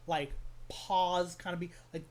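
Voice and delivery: usual speaking voice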